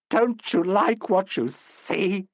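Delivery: high, slow, hysterical taunt; crazy quoting. A narrator's reading. Smeared actress taunts Jacko with a quote, high hysterical. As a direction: thrust it at him with crazy triumph. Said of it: "she quoted".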